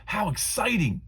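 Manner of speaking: high, bright grandmother voice; grandma cheering on her grandson, high and pleased; Grandma's voice. Acted. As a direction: play a delighted grandma.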